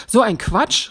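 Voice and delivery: lauter Ausruf, höher; gerufen. A narrator's reading. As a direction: ruf es empört hinaus. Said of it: "rief Petrus". (lauter empörter Ausruf)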